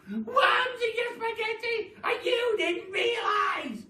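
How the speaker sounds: high, loud, slow shrieking jeer; mock him in a shrill witch voice; jeering Mrs Twit. Wife jeering at husband in high cackling voice. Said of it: "horrible laughter".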